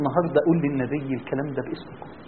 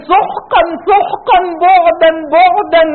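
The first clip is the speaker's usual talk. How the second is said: stern rejection; 12 dB louder, much slower than usual. Acted. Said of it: "أن يقول لنا يوم القيامة".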